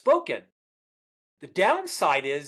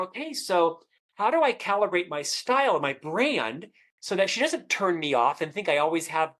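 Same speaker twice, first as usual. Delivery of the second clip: normal talking voice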